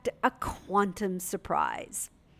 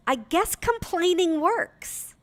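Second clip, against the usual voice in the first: delighted daughter; 9 semitones higher, slower than usual. Retold or imitated. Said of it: "and she goes".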